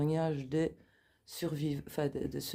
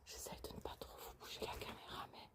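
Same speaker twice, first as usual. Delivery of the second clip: soft unvoiced whisper throughout; library whisper; 8 dB quieter than usual. Real, not acted. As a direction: keep it hushed, exploring in the dark. Whispering while exploring the house.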